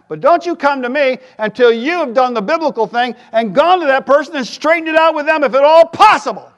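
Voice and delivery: high, loud preacher's exhortation; preaching. Real, not acted. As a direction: drive the point home from the pulpit. Preaching at full force.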